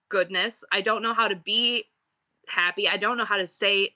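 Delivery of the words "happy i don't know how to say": ordinary talk, nothing marked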